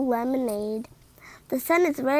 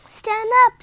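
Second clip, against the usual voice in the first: calling out; 8 semitones higher, 8 dB louder than usual. Acted. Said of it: "says Bob".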